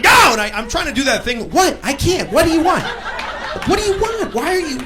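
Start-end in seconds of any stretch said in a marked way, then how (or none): 0.0-0.1 loud impatient yell; yelling driver. Retold or imitated. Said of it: "He keeps yelling". 1.5-4.8 high-pitched bewildered pleading; flustered protest. Acted. Acted flustered reply to yelling driver.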